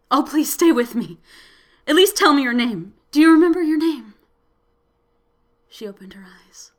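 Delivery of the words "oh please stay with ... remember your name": loud, pleading, rising fast; desperate pleading. A narrator's reading. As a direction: beg her to hold on. Begging dying woman to stay.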